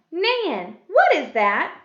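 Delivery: loud question, "Nan" high; question tune. Shown as a demonstration. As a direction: call "Nan", then ask loudly. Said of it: "This is a question".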